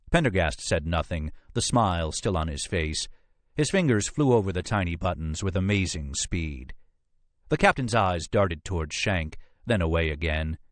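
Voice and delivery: ordinary delivery, nothing marked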